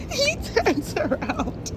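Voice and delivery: slow words broken by a laugh. Real, not acted. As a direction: laugh through the line. Laughs at the puppy.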